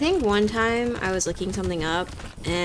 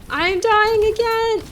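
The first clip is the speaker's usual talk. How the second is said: high, loud, drawn-out wail of panic; panicked cry; 13 semitones higher, 10 dB louder, slower than usual. Real, not acted. Gamer losing her character again, panicked cry. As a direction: cry out as the health runs out. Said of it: "Oh, I'm dying again".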